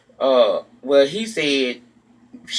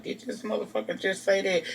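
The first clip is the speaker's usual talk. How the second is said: hushed disbelieving inner voice, pitched up; quiet stunned thought; 5 semitones higher, 8 dB quieter than usual. Retold or imitated. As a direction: murmur it like a shocked silent thought. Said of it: "one of them quiet moments".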